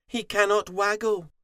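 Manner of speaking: high, loud fox voice; high, forceful explanation; fox confirming. A narrator's reading. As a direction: announce it emphatically as the fox. Fox confirms to stunned Crow.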